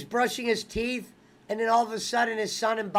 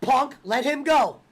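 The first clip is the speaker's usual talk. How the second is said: ordinary delivery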